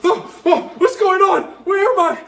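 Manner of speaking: hammed-up frightened confusion; mock panic. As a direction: play lost and scared. Retold panic at the visions.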